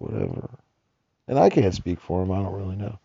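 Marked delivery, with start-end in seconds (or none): 0.0-0.5 low, quiet, dismissive shrug of a word; dismissive whatever. Retold or imitated. A man shrugging off the financial world, dismissive mutter. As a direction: toss it off like you couldn't care less. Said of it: "he's just like".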